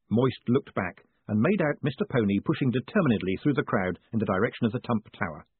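ordinary delivery

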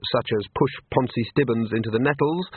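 plain reading voice

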